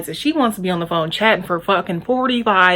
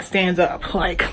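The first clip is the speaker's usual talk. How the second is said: normal talking voice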